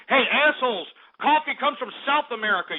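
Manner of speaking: ordinary delivery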